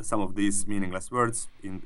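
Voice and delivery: ordinary talk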